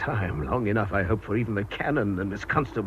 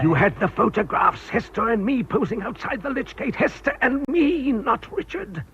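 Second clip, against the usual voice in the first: rising, tense accusation; pressing her hard, voice climbing high; agitated; 14 semitones higher, 3 dB louder than usual. Acted. Villain corners Miss Chant in the bell tower, agitated and high.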